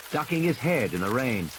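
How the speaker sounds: usual speaking voice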